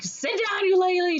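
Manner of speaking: very high-pitched squawked command; mock angry order. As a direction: imitate an outraged husband ordering his wife down. Mayor orders his wife to sit.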